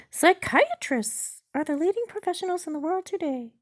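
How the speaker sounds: high-pitched, loud bot character; chatbot voice. Acted. Chatbot names leading professionals to user, high bot voice.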